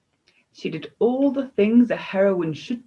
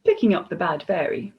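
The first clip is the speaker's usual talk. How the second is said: ordinary delivery, nothing marked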